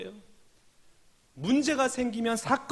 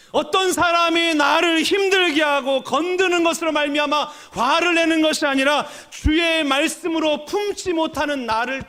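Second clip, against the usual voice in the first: high, sustained pulpit cry; full-force preaching; 6 semitones higher, 6 dB louder than usual. Real, not acted. Preacher urging congregation to be angry at themselves, full-force preaching. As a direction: exhort the congregation with raised, ringing urgency.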